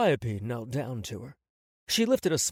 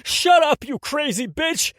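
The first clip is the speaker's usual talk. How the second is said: much higher, loud furious scream; 13 semitones higher, 8 dB louder than usual. Acted. Tony screams at Calliope in fury, loud scream. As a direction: tear into her with rage. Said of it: "Tony screamed at her".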